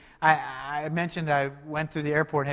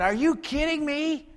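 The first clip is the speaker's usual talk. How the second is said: much higher, disbelieving retort; incredulous; 12 semitones higher than usual. Retold or imitated. Pastor reenacting his reply to airport security, incredulous and high. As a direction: throw up your hands in disbelief. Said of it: "And I said".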